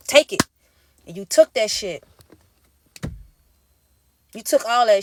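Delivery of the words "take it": higher, louder mock abuser; demanding abuser. Abuser to partner, a mock command. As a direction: bark the second take it. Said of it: "abuser's voice quoted".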